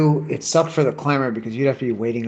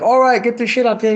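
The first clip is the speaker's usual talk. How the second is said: high-pitched mock boss; imitated bossy order; 10 semitones higher, 4 dB louder than usual.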